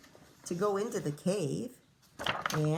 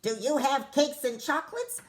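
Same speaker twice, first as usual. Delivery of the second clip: higher, louder greedy cry; the robber calling into the cave; 6 semitones higher, 4 dB louder than usual. Acted. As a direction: cry out hungrily. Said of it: "the highway robber cried".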